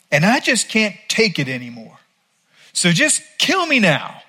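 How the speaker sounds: high-pitched, exasperated outburst at God; angry complaint. Retold or imitated. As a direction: whine it out like a prophet fed up with mercy. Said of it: "because he was angry".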